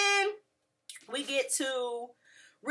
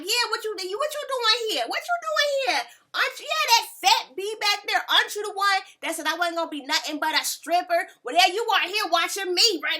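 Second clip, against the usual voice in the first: high, loud, confrontational [name] impression; imitating [name]; 8 semitones higher, 5 dB louder than usual. Vlogger imitating Cardi checking a heckler, high and loud. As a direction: call out the heckler, squeaky and loud. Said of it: "Cardi's like".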